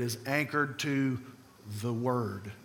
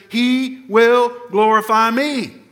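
emphatic quote; 6 semitones higher, 11 dB louder, slower than usual. Real, not acted. Quoting Jesus at a climax.

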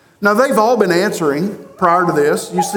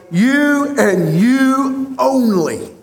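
"You" leaned on hard, said higher and slow; emphatic you; 5 semitones higher, much slower than usual. Retold or imitated. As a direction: press down on "You" both times, unhurried. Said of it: "you is emphatic".

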